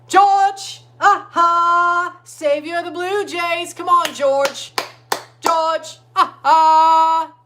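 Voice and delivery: high, loud, drawn-out cheer; excited shouting. Real, not acted. Fan yelling to batter George, excited shouting.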